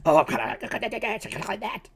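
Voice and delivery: a lightly voiced old man trying it out. Shown as a demonstration. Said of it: "He's kinda old, so let's go".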